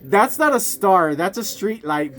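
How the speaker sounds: ordinary delivery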